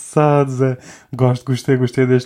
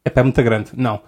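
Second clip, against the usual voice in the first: ordinary delivery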